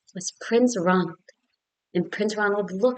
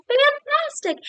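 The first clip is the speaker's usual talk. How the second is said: very high, loud, drawn-out cheer; high excited shout; 6 dB louder, slower than usual. Acted. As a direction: cheer the dragon on like a fan. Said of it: "And Elizabeth shouted".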